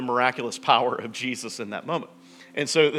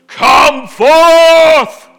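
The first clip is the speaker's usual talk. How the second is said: huge, high, drawn-out shout; big booming voice; 19 dB louder than usual. Retold or imitated. Jesus summoning Lazarus from the dead, booming shout. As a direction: bellow it out at full power, holding the last word. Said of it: "the big booming voice of Jesus goes".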